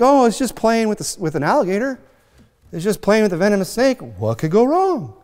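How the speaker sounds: high-pitched, blithely unconcerned; mock naive. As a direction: innocent act, pitched well up. Mimics someone naively dismissing danger.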